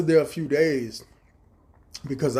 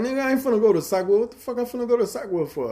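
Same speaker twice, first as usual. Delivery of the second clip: incredulous retort; 9 semitones higher, 3 dB louder, faster than usual. Retold or imitated. Gang chief retorting in disbelief.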